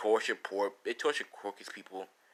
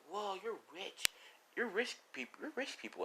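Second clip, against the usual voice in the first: high, soft, awed voice; a child marvelling at a mansion; kid's amazement; 10 semitones higher, 10 dB quieter than usual. Retold or imitated. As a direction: gape at the wealth in a small high voice.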